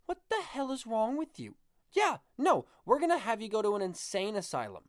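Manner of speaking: lightly acted appalled boss; incredulous boss. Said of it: "your boss would be like".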